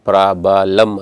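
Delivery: ಪ್ರಾಬಲಮ್ in three beats, pra-ba-lam, extra vowel after b; mispronounced example. Shown as a demonstration. Teacher warning students off ಪ್ರಾಬಲಮ್, wrong three-syllable pra-ba-lam. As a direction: put a full "ba" inside ಪ್ರಾಬಲಮ್. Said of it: "ಅಲ್ಲ".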